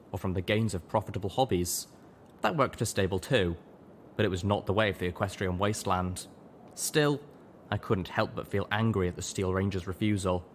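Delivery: ordinary delivery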